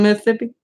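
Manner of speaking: "Mississippi" lisped as Miss-thippi; teasing, lisped "Mississippi" as a question; mock Mississippi accent. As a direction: ask "Mississippi" with TH replacing the S. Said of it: "making fun of Crystal's accent".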